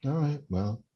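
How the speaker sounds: ordinary talk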